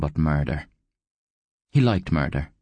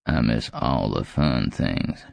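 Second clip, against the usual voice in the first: put-on low, slow, joyless old man; deadpan, aged growl; humourless old growl; 6 semitones lower, slower than usual. A narrator's reading. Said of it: "Scarab said without humour".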